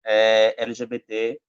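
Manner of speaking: ordinary talk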